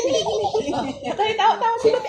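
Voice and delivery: high, giggling through words; laughing. Real, not acted. Laughing while tickling.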